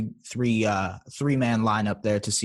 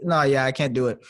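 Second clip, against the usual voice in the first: ordinary talk, nothing marked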